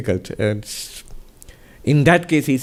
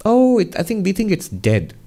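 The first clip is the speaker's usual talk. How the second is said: startled villager; 7 semitones higher than usual. Retold or imitated. Villagers answer the guru.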